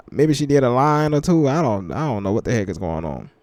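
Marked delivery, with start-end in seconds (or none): none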